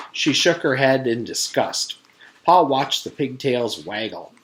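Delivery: ordinary delivery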